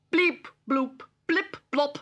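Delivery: high, bouncy bleep-bloop robot motor sounds; robot motor noise. A narrator's reading. Storyteller voicing Digby's robot motor, high bleeps. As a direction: chirp it like a little toy robot motor. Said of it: "went his little robot motor".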